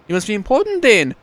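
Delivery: a hint of a curious townswoman; voicing Frida. Frida questioning the stranger, lightly voiced woman.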